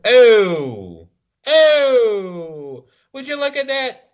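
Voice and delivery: very high, loud, hammed-up gasp of wonder; goofy amazed onlooker. Clowning around at tournaments as an amazed spectator, very high and loud. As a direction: gush like a cartoon gawker. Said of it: "I just do that at tournaments the whole time".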